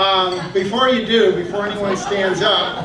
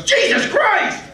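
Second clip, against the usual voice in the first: very high, loud mock shriek of shock; drawn-out, hammed-up cry of horror; horrified shout; 6 dB louder, much slower than usual. Father seeing his newborn, acted horrified shout. Father first sees the ugly baby.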